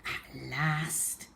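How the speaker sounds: ordinary delivery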